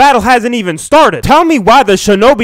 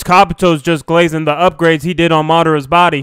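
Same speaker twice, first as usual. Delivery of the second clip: ordinary talk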